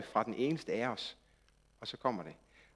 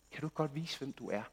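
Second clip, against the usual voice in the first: calm quiet prophet; 3 semitones lower, 10 dB quieter than usual. Retold or imitated. God's prophet calmly asks God.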